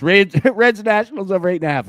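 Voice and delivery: laughing. Real, not acted. Laughing through the picks after a sneeze.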